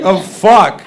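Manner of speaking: normal talking voice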